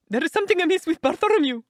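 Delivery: high, loud, panicky Sholto; frightened cry. A narrator's reading. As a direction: cry out in alarm, pitched right up. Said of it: "he cried".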